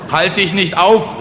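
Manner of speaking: loud, high, slow heckle; calling out. Acted. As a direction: shout it from the back of the crowd. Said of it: "rief jemand hinten". (lauter Zwischenruf von hinten)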